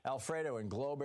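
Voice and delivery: ordinary talk, nothing marked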